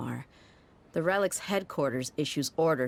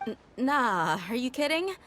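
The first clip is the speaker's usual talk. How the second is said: flustered, pitch jumping high on the denial; nervous denial; 9 semitones higher than usual. Acted. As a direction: stammer out a jittery brush-off. Nervous denial after being questioned.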